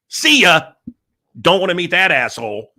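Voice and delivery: high, loud blow-off; loud dismissive exit. Retold or imitated. Walking away from [name] backstage, loudly dismissive. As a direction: call it out and bail.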